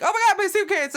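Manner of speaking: suddenly spot the suitcase, high and loud; startled cry. Retold or imitated. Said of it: "and he's like".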